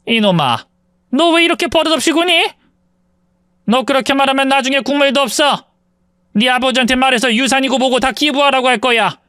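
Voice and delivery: mother-in-law pitched very high, threatening her son; shrill-pitched threat to cut off the inheritance; furious mother-in-law. Acted. (아주 높은 톤으로 아들을 협박하는 시어머니)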